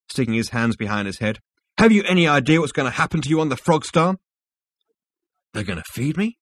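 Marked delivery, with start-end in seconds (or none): 1.8-4.2 fast, higher, louder character voice; Rooster challenging. Acted. Rooster challenges Zaphod about the Frog Star, quick and loud. 5.5-6.4 tentative question rising on "me"; hopeful. A narrator's reading. Zaphod hazards a guess about food, hopefully, rising on "me". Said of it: "hazarded Zaphod hopefully".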